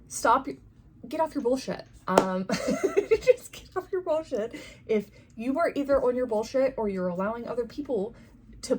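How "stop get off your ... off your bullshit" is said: high, sassy, mock-scolding, with a laugh at the end; sassy card voice. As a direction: tell yourself off like a cheeky friend. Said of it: "So it's saying".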